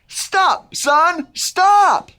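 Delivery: high, emphatic group-leading shout; playful yell. Pretend. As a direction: call it up to the sky, high and punchy. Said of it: "Everyone yell".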